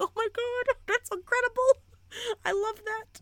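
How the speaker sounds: very high, squeaky gush; sky-high fangirl squeak; squealed gushing. Retold or imitated. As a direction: squeal it in a tiny, overwhelmed voice. Gushing reaction to the author's quote.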